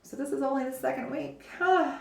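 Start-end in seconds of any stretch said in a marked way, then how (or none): 1.6-2.0 surprised huh. Real, not acted. Surprised reaction.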